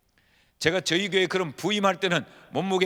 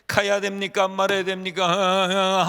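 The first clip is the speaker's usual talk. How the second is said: louder, slow pleading question; pleading prayer; 3 semitones higher, 6 dB louder, slower than usual. Retold or imitated. Pastor re-enacts asking God whether to stay or leave. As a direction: drag out 말아야 as if begging. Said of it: "하나님은 아주 굵고 짧게".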